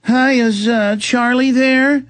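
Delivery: lightly voiced phone caller, drawn out; fake friendly caller. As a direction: play the creepy caller asking for the husband. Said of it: "They'll call up and go".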